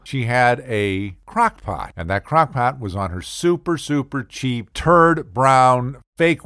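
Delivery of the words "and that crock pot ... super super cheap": ordinary talk, nothing marked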